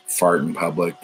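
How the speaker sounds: normal talking voice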